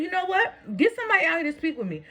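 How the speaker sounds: high-pitched, quickening demand; worked-up storyteller replaying her demand; heated retold demand. Acted quarrel at front office.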